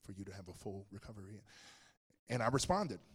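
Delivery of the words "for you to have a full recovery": hushed, low imitation of a doctor; near-whisper, gently breaking bad news; doctor's whisper voice. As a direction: drop low and very soft, like a consulting doctor. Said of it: "talking in that whisper voice".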